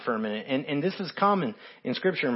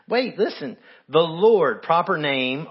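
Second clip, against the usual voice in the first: ordinary talk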